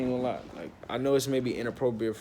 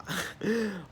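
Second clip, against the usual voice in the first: short, high chuckle; laugh; 8 semitones higher, 4 dB quieter than usual. Real, not acted. Storyteller recalls refusing the manager, laughing. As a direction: laugh off the nonsense.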